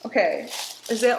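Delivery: ordinary delivery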